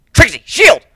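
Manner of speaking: loud, high shouted order; urgent call. A narrator's reading. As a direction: bark the command fast. Calling out in ambush.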